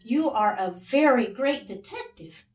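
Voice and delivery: high, admiring king; king's voice. Acted. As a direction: praise him like a grateful little king. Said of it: "the king said to Big Max".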